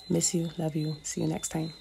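usual speaking voice

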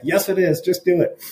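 high-pitched mock insistence; retold insistent reply. Speaker texting back to doubting colleague, acted insistence. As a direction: act out urging someone on, pitched well up.

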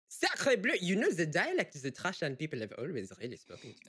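high, loud French opening, settling down; French accent. Acted. Actor auditioning a Trashland voice, French accent. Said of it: "if I did a French accent".

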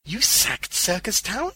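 high, loud, slow question; stunned old man's question; astonished echo. A narrator's reading. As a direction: repeat it in disbelief, rising at the end. Old man echoes the news astonished.

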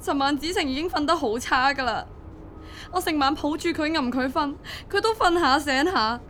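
frightened parent recounting an emergency; distraught worry. Acted. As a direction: pour out the whole ordeal in rising panic. Panicked mother recounting child's hospital admission.

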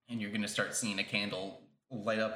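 ordinary talk, nothing marked

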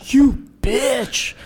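high, loud insult; angry yell. Retold or imitated. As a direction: snap it at her in a high voice. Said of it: "And he goes".

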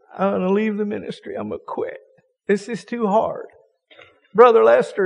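high, whimpering mock lament; mock crying pastor. California pastor whines to Lester he is burned out, mock crying. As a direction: imitate a burned-out preacher half-sobbing. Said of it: "he starts crying to Lester".

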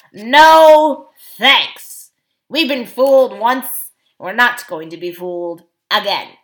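loud, emphatic dwarf refusal; stubborn dwarf, fed up; scornful dwarf. A narrator's reading. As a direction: refuse flatly at full voice.

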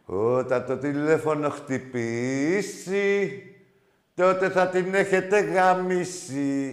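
slow, weighty mock warning; slow ominous. Real, not acted. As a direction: spell it out slowly like a doom warning.

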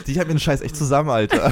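words through real laughter; laughing. Real, not acted. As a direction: laugh while you say it. Laughing at the joke.

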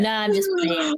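playful Brian line. Acted. Reading Brian in boy band skit.

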